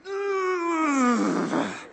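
long high strained groan of effort; straining sigh. Retold or imitated. Husband straining at the pickle jar, strained groan. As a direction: groan like you're wrestling a stuck lid.

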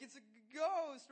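loud slow panicked cry; frightened shout. Retold or imitated. Disciples in the storm shouting in fright. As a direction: yell it like you've seen a ghost.

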